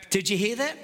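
ordinary talk, nothing marked

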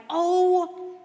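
loud, slowed cry of disbelief; exasperated outburst. Retold or imitated. Believer caught off guard by a yelling boss, loud exasperation. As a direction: throw it up like your boss just broke you.

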